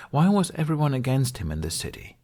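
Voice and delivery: ordinary delivery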